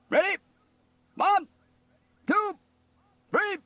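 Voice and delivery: very high, loud, separated counts; barked count-in. A narrator's reading. Steele counts in the band, barking. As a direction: bark each number with a gap.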